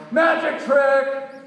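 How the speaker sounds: high, loud sing-song yell, slow. A narrator's reading. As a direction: belt it out like a showman. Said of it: "she yells".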